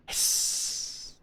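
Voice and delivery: voiceless hissing door noise; breathy sss of a closing door; pneumatic hiss. Acted. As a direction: hiss like a sliding train door. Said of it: "You don't have to do that, mate".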